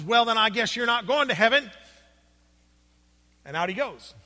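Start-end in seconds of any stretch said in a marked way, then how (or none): 0.0-1.7 louder indignant parting shot; stormy parting shot. Retold or imitated. Angry street preacher storming off from the men, indignant. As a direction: snap it back while storming off. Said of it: "just kinda storms off".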